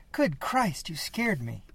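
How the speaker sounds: high, jumpy gasp of fright; startled. Acted. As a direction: caught off guard, hand to chest. Startled from behind.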